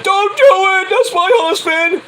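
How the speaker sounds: mock screechy wife, very high; pleading wife. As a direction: plead in a high, loud falsetto for your husband. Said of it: "Maurice tries to stop him".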